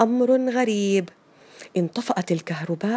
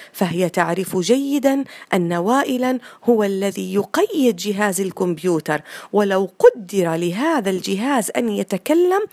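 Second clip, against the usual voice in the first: ordinary delivery, nothing marked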